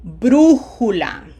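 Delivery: Brújula, slow, with a loud BRÚ; stress on third-last syllable. Shown as a demonstration. Teacher letting students verify, slow Brújula stressed on BRÚ. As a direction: punch and hold the first syllable of Brújula. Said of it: "on the third syllable. Third from the right".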